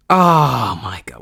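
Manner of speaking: ordinary delivery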